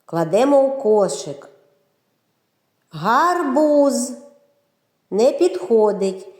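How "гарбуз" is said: Гарбуз raised and drawn out in two long syllables; протяжно по складах. Shown as a demonstration. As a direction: sound out Гарбуз with held vowels. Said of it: "в назві яких є звук О". («Гарбуз» протяжно, високим голосом по складах)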